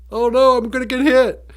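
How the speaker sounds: high, loud voice of an alarmed deer; mock panicked deer. Deer staring into the headlights, mock panic. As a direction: play a deer panicking, comically high-pitched.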